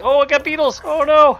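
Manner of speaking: high panicked shout; panicked yelp. Real, not acted. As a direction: yelp in sudden alarm. Beetles attacking him in game.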